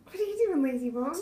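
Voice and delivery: high, loud playful tease; playful loud teasing. Acted. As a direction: tease him awake, big and bright. Mom teasing child to wake up.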